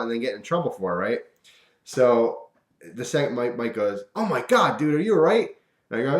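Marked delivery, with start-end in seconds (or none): 4.1-5.5 high, louder, alarmed Oh my God dude; concerned buddy calling out; worried friend. Retold or imitated. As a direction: friend checking he's all right. Mike asks about the hit hand.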